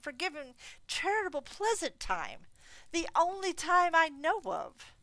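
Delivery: loud, high, heartfelt plea; earnest nephew. Acted. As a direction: speak up for Christmas sincerely.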